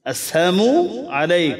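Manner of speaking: "আসসালামু" spaced out as "as-saamu", with no "la"; "as-saamu" shown word by word; missing "la". Shown as a demonstration. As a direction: say "as-saamu... alaik", pausing between the words. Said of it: "এটার অর্থ".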